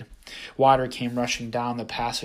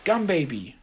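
higher, louder call; shouted; 6 semitones higher, 4 dB louder than usual. A narrator's reading. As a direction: call out to her. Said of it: "I shouted".

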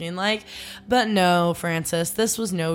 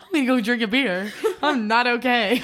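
laughing through a mock husband line; half-laughing imitation; laughing mock quote; 5 semitones higher than usual. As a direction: giggle while playing the freezing guy. Jokingly voicing shivering husband.

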